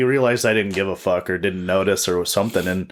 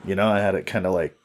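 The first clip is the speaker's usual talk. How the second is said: ordinary talk, nothing marked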